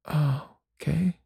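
devastated. Retold or imitated. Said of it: "she was really devastated".

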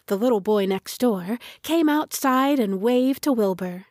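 ordinary delivery, nothing marked

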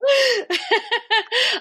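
high burst of delighted laughter. Real, not acted. Delighted by the reading.

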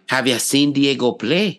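demonstrated Irish accent, lifting at the end. Shown as a demonstration. Saying the starter line in an Irish accent. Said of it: "for me to do Irish".

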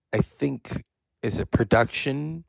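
ordinary delivery

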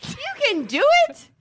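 high mock cheer.

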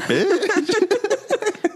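very high squeaky diss; squeaky high sass. Retold or imitated. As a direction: squeak it out cheekily. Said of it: "Paddington was like".

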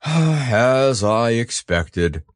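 heavy sigh, then slow resignation; gloomy donkey. A narrator's reading. Eeyore examines his cold tail, resigned gloom. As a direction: sigh and drag the words out.

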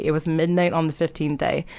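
ordinary talk

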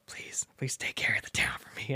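whispered last words, soft; dying whisper. Retold or imitated. Merle asks Woody to take care of the town, whispered. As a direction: breathe it out like a dying father.

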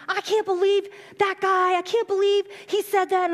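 high, indignant outrage; indignant moms. Retold or imitated. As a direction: sound scandalized for her. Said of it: "moms and everybody were coming".

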